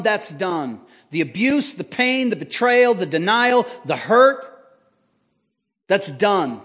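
usual speaking voice

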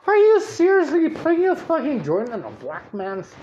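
high, loud mock disbelief; incredulous protest. Friend protesting being handed the joint, incredulous. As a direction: act out an alarmed, incredulous friend.

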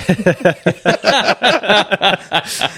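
a high, hearty real laugh; burst of laughter. Real, not acted. Laughing at his own story.

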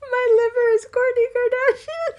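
high, loud laughing through the words; giggling squeal; laughing hard. Real, not acted. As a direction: crack up mid-sentence. Laughing at own joke.

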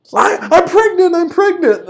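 high, urgent mock announcement; Maria. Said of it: "She goes".

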